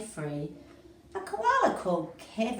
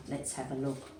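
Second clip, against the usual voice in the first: plain reading voice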